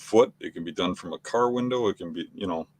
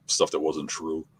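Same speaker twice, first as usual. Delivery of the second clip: ordinary delivery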